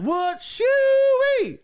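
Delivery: very high, loud hillbilly holler; amazed country whoop. Retold or imitated. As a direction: whoop in mock astonishment, stretching it out. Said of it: "it was kind of that vibe".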